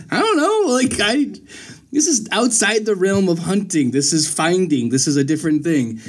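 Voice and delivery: retold comic indignation; baffled protest. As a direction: start high and exasperated, settling as you explain. Retelling own baffled protest.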